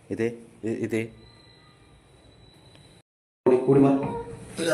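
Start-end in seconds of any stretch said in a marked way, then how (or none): 3.5-4.7 drawn-out urging. Real, not acted. Urging dog to jump.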